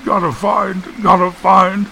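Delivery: high, tearful character voice; acted upset simpleton; crying. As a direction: fret in a high voice, repeating yourself. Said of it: "Eustace started crying again".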